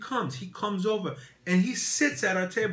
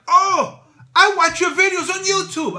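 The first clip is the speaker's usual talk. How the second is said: high, loud, starstruck gush; excited fan; 12 dB louder than usual. Retold or imitated. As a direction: gush like meeting a favorite YouTuber. Owner recognizes the YouTuber.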